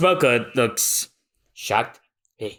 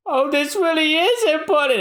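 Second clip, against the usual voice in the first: much higher, fretful voice; high-pitched Usopp. Acted. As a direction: squeak it out like a nervous coward. Usopp voiced in manga reading.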